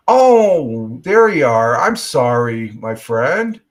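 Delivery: loud, delighted "oh, there you are"; excited loud greeting. Real, not acted. Greeting a viewer who finally appears.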